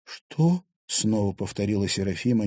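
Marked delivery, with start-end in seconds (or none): 0.0-0.6 high shocked gasp of a question; can't believe your ears; disbelieving. A narrator's reading. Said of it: "не веря своим ушам". (ошеломлённо, высоко)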